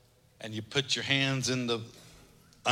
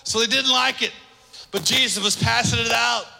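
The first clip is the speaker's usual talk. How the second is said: loud, high-pitched pulpit proclamation; full-force preaching; 11 semitones higher, 9 dB louder than usual. Acted.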